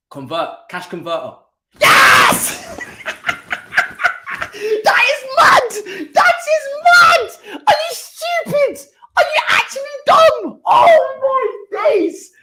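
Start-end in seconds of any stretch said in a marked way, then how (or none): 1.8-2.6 loud breathy yes; real cheer; cheering shout. Real, not acted. Winning penalty scored. 2.7-4.8 high, screaming laughter; real delighted shriek. Real, not acted. Winning penalty scored. 4.8-12.3 much higher, loud, laughing yells; real laughing outrage; ecstatic shouting. Real, not acted. Celebrating shock result.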